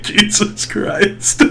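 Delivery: hushed, very slow disbelief; stunned reaction. Retold or imitated. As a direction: let it out softly, stretched, under the breath. His stunned reaction in the retelling.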